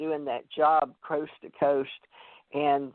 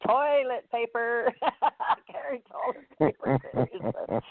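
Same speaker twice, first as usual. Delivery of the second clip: higher, slow words through real laughter; laughing; 8 semitones higher, slower than usual. Real, not acted. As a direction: crack up while saying it. Cracks up over toilet paper.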